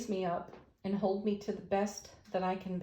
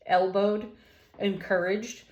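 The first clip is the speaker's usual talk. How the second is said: ordinary talk, nothing marked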